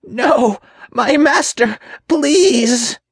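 extremely high, desperate demon wail; terrified imp pleading; pleading for clemency. A narrator's reading. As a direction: beg your master for mercy in a high squeal. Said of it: "his annoying pleas for clemency".

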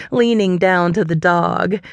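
ordinary delivery

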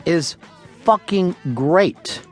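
normal talking voice

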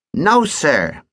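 put-on lazy teasing kid; cheeky boy voice. Ogden denies.

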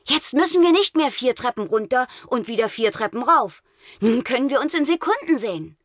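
put-on eager boy voice. Kai's voice in story.

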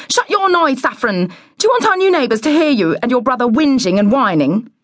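high, quick, cross telling-off; scolding mother. A narrator's reading. Mother scolding her children before the neighbours, cross and high. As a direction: start high and rattle off the scolding.

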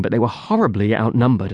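usual speaking voice

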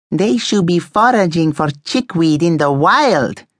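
indignant rant, slowly and emphatically delivered; cross. A narrator's reading. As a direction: lecture them crossly in an accented character voice. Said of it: "Eduardo said crossly".

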